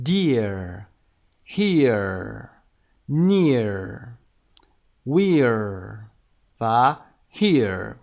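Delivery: single words said slow and clear, each one stressed; model word list. Shown as a demonstration. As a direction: read each word out for repetition. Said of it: "برای صدای ear".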